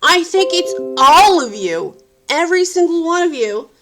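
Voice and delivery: loud, high, heated venting at the crew; angry rant. Real, not acted. Complaining about the film crew, loud and furious. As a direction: let the frustration boil over.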